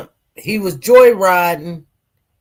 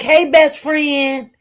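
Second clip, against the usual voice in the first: higher, drawn-out mock greeting; chummy greeting; 6 semitones higher, slower than usual. Retelling two best friends greeting each other, chummy. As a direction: imitate a pally hello, slow and high.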